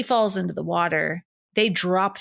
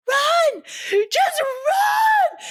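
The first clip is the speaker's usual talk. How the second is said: frantic shout to run; much slower than usual. Retold or imitated. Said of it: "she was like".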